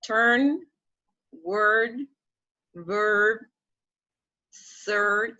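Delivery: "turn, word, verb, third" said singly, long pauses between; drawn-out "er" vowel in "turn, word, verb, third"; model pronunciation. Shown as a demonstration. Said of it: "this sound in the words".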